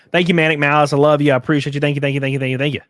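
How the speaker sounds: loud, rapid-fire thanks; loud hype thanks. Real, not acted. Streamer loudly thanking a donor, hyped. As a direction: shout the thanks over and over.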